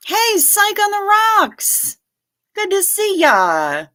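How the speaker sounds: high, slow, loud hello to a regular; sing-song greeting. Real, not acted. Streamer greets a regular viewer who just arrived, sing-song and loud. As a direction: call out a happy welcome.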